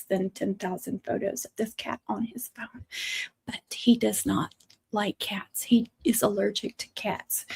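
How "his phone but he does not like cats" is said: hushed, breathy aside with slower words; whispered aside. Real, not acted. Whispering a tease about her cat-hating husband. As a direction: let it slip out like a secret tease.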